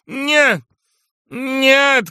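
high, loud refusals; anguished shout. A narrator's reading. General refuses to be calmed, anguished shout. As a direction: cry out in despair. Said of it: "кричал генерал".

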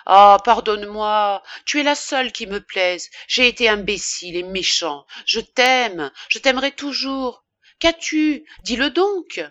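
loud, rising outburst of love; impassioned cry. A narrator's reading. Lover begging forgiveness of his weeping mistress, crying out passionately. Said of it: "Et alors il s'écria".

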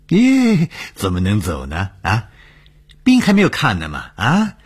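high, loud squeal of mock surprise; exclaiming protest. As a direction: protest in high yelps to stop someone leaving. Doctor stops departing patient.

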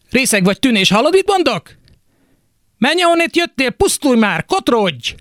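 put-on mother screaming at her drunk son to get out; furious dismissal. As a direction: drive him out the door, getting shriller.

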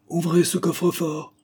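high-pitched threatening order; menacing. A narrator's reading. Masked gunman demands the safe be opened, menacing. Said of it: "dit l'homme d'un ton menaçant".

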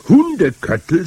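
high, loud horrified shout; shouted in horror. A narrator's reading. Boy shouts at his grandmother in disgust. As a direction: cry it out in disgust and disbelief. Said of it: "schrie ich".

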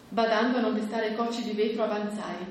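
plain reading voice

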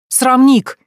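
high, loud scandalised cry; indignant shout. Acted. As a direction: shove him off with the word. Pushing intruder out window. (возмущённый крик)

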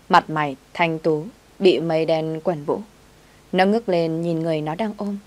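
plain reading voice

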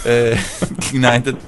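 ordinary delivery

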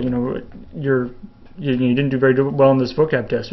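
ordinary talk

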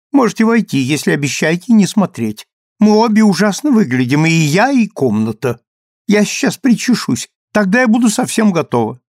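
high, bright flustered chatter; high chatty voice. Acted. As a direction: gush it out quickly. Ruth chattering.

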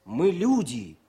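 slow, measured verse reading with pauses; poem recital. Acted. Officer reads the tank poem to raise a wounded tankman's spirits.

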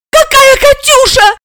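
put-on very high child's exclamation; stunned little girl, high-pitched; astonished. A narrator's reading. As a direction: squeak in amazement. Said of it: "поразилась Алиса".

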